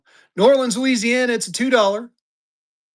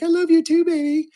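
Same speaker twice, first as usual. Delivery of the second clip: voicing the woman on the banknote higher, louder; lovestruck woman; 9 semitones higher, 3 dB louder than usual. Host voicing the lady on an old banknote, lovestruck. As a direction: coo it like a smitten lady in a picture. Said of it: "And she's like".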